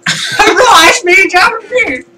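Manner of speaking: very high-pitched caricature of Japanese; mock Japanese accent. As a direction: imitate someone badly faking Japanese, shrieked high. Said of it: "you doing Japanese is like".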